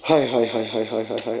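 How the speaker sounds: low, fading cries of grief; mourning wail. Acted. Mourning lament in majlis. (ہائے ہائے کا دھیما ہوتا ماتم)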